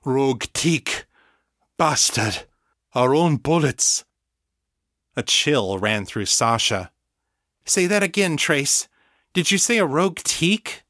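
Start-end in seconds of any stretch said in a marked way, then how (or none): 0.0-4.0 broken bursts with pauses between; a hint of a breaking-up transmission; gasp-free fragments, each phrase standing alone; fragmented, disjointed. A narrator's reading. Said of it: "fragmented and disjointed by the shaky connection". 7.6-10.8 high, alarmed request to repeat; urgent questioning. A narrator's reading. Sasha urgently questions dying Trace.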